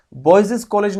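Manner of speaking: put-on बॉयज said as "boy-ziz"; wrong extra syllable, "boyziz" for बॉयज; doubled s. Shown as a demonstration. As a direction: tack a second buzz onto बॉयज. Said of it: "नहीं बोला जाता है".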